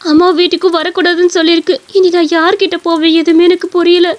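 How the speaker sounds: high, distressed wife voice; pleading, despairing wife. Acted. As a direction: beg him to stay, then lament helplessly. Wife begs, then despairs. (பரிதவித்து கெஞ்சும் மனைவி)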